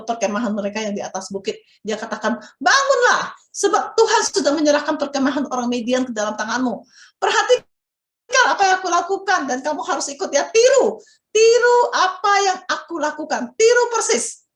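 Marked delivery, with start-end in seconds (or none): 2.6-14.5 high, loud urgent rallying call; Gideon rousing his men. Acted. Gideon rousing his three hundred soldiers, high and loud. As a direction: wake the troops and urge them on. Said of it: "Itu kata Gideon".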